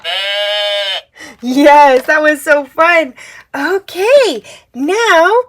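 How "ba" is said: soft low baa; sheep bleat. Shown as a demonstration. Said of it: "The sheep says".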